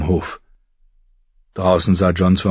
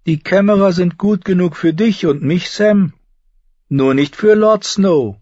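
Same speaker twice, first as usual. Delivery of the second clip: higher, mocking voice; mocking Darion; 11 semitones higher than usual. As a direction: needle him with a sneering grin.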